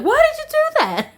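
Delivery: high, far-off wail of hurt; mock-devastated high protest. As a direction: cry out betrayed.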